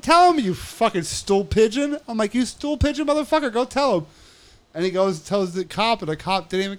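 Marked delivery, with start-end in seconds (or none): 0.0-2.0 loud, high taunt; heated retold challenge; scornful dare. Retold quarrel, daring the snitch. 2.3-4.1 high-pitched, heated taunt; mocking retold challenge; scornful dare. Retold quarrel, daring the snitch.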